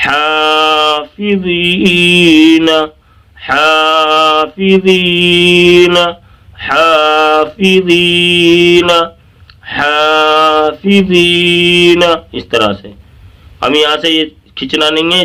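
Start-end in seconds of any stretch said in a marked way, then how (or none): 0.1-12.0 slow chant of حافظین with long haa and zee; stretched recitation. Shown as a demonstration. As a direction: sustain the first and last long vowels of حافظین. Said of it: "اسے کھینچے پہلے".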